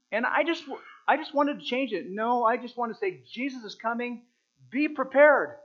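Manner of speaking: high and loud, full-force preaching; rising to a loud preacher's cry; preaching shout. Real, not acted. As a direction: belt the punchline at the congregation. Punchline shouted at the congregation.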